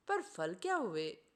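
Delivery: plain reading voice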